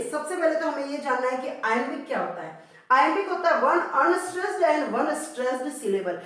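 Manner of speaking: normal talking voice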